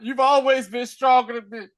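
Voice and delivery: hammed-up pleading son; mock son voice. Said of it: "he was like".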